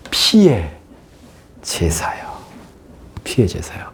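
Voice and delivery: hushed, unvoiced emphasis; whispered. Real, not acted. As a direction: breathe the words for weight. (속삭이듯 강조)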